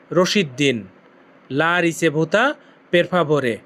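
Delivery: slow, careful teacher's drill of the request; slow demo. Shown as a demonstration. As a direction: repeat "La ricevuta, per favore" slowly for learners. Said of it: "তো কি বলবেন Italian ভাষায়?".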